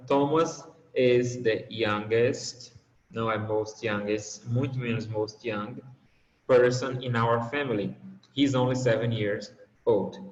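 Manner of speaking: slow, deliberate reading of the sentence; slow model reading. Shown as a demonstration. Teacher reads the correct sentence slowly to the class. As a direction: spell the sentence out slowly for learners. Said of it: "ele só tem uma sílaba".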